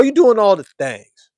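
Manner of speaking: ordinary delivery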